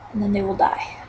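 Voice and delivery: whisper. Acted. Gloating whisper about kill.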